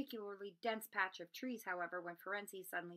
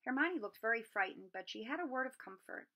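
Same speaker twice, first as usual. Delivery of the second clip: usual speaking voice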